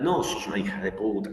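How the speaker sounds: ordinary talk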